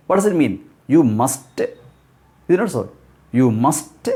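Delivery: ordinary talk, nothing marked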